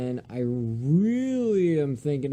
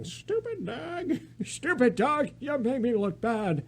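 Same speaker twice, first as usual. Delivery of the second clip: put-on high, slow grumpy old man; Eustace voice; 8 semitones higher than usual. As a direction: sneer it like a cranky cartoon farmer. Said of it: "That's Eustace with my old man voice".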